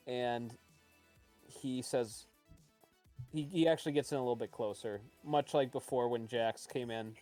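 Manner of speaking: usual speaking voice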